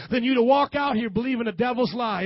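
usual speaking voice

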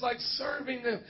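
usual speaking voice

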